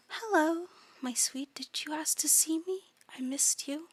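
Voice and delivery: soft, whispery eldritch coo; hushed, tender monster voice; eerie doting creature. Acted. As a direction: coo softly and sweetly from the dark.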